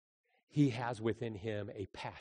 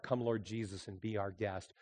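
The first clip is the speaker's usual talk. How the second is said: rote prayer; 3 semitones lower, 7 dB quieter than usual. Retold or imitated. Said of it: "your prayers aren't".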